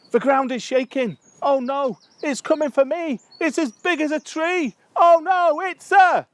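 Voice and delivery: high, loud panicked cry; hammed-up terror. Someone scared of a bumblebee, hammed-up terror. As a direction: wail the poem in mock panic. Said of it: "someone who's scared of one".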